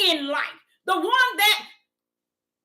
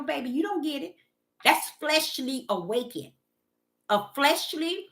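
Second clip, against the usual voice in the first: ordinary delivery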